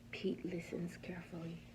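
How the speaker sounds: soft whispered storytelling; suspense whisper. A narrator's reading. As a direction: whisper it like a secret to the kids. Suspenseful bedtime story narration.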